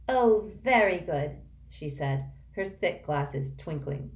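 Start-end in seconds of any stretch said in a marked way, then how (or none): 0.1-1.4 slow sarcastic praise. Acted. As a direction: drawl it out sarcastically. Myrtle mocking Harry.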